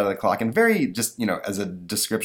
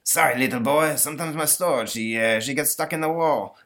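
put-on French accent; 6 semitones higher than usual. Napoleon apologizes.

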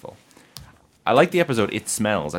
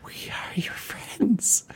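slow, high creepy whisper; 11 semitones higher, much slower than usual. Pretend.

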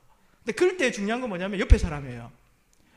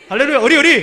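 shout; 4 semitones higher, 10 dB louder than usual. Real, not acted. Rallying congregation about loyalty.

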